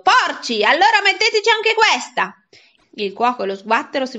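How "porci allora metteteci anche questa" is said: high, loud furious yell of disgust; furious shout. Acted. Boy hurls his shoe at the cooks, yelling in fury. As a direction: scream it down at them in rage.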